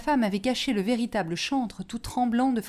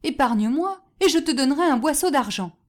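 higher, louder plea for mercy; raised, pleading cry; 5 semitones higher, 5 dB louder than usual. A narrator's reading. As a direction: beg urgently to be spared. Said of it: "cria le chantre".

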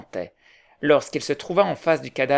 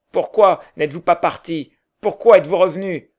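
loud, rising demand; 4 semitones higher, 9 dB louder than usual. A narrator's reading. An onlooker loudly demanding why Potter came back. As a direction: press him hard for an answer.

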